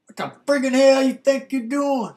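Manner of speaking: loud high mock yell; angry boyfriend yelling; retold yell. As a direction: shout it like an angry ex.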